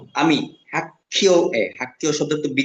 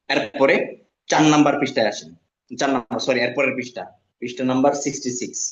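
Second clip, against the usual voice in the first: normal talking voice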